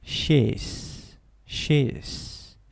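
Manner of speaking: "She's" said softly, the s quick and short. Shown as a demonstration. Teacher models "She's" with a short S. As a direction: say "She's" with just a plain S sound. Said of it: "se pronuncia simplemente con una S".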